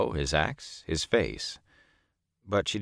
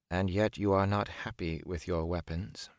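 softer, knowing and gentle; quiet penetrating calm; 6 dB quieter than usual. A narrator's reading. Leah calmly reads Perrin's doubts, soft and knowing. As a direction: say it softly, seeing through him. Leah's calm perceptive reply.

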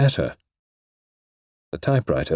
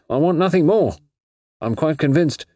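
mildly deferential, higher-pitched assurance; respectful; 9 semitones higher than usual. A narrator's reading. Inspector convinced by the suspect's papers, respectful. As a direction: assure him politely, pitch raised. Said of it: "he said respectfully".

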